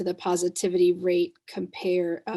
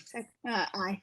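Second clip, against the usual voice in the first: tired voice; 8 dB quieter than usual. Real, not acted. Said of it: "That's my tired voice".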